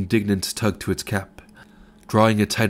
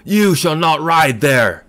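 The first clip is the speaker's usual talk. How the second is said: slow, much higher furious bellow; enraged old man bellowing at a cyclist; 7 semitones higher, 7 dB louder, slower than usual. A narrator's reading. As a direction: roar it like a beast. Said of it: "bellowing, no longer a human voice".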